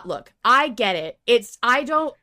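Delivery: ordinary talk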